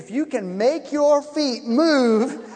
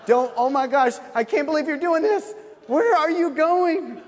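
building higher and louder, slowing to the end; panicked; 6 semitones higher, 5 dB louder than usual. Retold or imitated. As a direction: talk yourself out of it in a panic. Retelling his own panic walking up.

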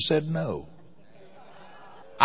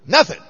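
NOTHING flung out high and loud; emphatic nothing; 9 semitones higher, 4 dB louder than usual. Retold or imitated. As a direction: hit Nothing hard and high, once. Flight attendant refusing the others.

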